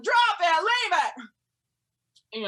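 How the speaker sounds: loud, high commands to the dogs; held-back yelling. Retold or imitated. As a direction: yell it, just short of full volume. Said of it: "I would really yell".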